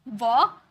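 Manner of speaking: वॉक with lips rounded into a W; वॉक with a clear W, lips pushed round. Shown as a demonstration. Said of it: "वॉक यानी कि डब्ल्यू क्योंकि मुंह round हो रहा है".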